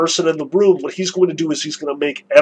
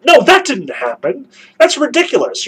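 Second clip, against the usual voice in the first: indignant protest; 6 semitones higher than usual. Pretend. Miusov voiced protesting Fyodor's story.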